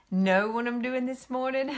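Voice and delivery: ordinary talk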